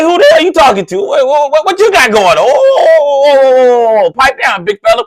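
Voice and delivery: clapping back at a rude parent, full ignorant mode. Retold or imitated. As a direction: get in his face and go off. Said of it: "I turned into ignorant mode".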